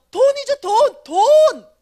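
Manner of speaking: very loud, high, drawn-out cry for money; desperate cry. Retold or imitated. Her heart screaming for money before the three men, drawn-out yell.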